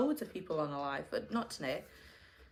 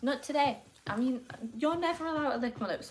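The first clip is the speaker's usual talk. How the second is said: scolding; 5 semitones higher, 5 dB louder than usual. Real, not acted. Scolding the dog.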